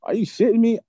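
high-pitched, indignant disbelief; reliving an incredulous outburst; retold boiling anger. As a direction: throw the question out, outraged and high. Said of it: "now my blood is boiling".

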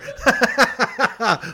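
real high-pitched laugh; laughing at own slip. Real, not acted. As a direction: crack up at your own mistake.

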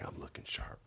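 hushed, whispered aside; whispered self-congratulation. Retold or imitated. Man recalling his thought about his dinner outfit, whispering. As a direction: whisper it as a private boast.

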